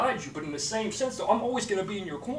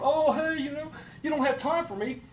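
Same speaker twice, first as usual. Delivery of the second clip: mock whining complainer; 9 semitones higher than usual. Mocking whiners in a rant.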